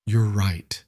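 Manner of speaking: a touch of shame, understated; small ashamed voice. Acted. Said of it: "Harriet's voice was small, ashamed".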